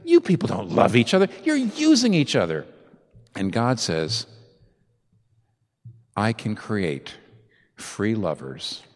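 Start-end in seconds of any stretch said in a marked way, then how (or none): 0.0-2.7 cynical. Retold or imitated. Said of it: "Satan is the cynic that says". 6.2-8.8 solemn. Retold or imitated. God's voice in sermon.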